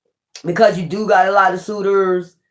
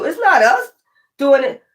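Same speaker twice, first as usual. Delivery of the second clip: high mock voice, shifting blame; mocking exes; 5 semitones higher than usual. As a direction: parrot the excuse high and scornful. Mocking what exes claim.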